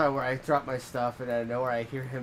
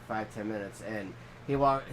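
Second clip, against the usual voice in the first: ordinary delivery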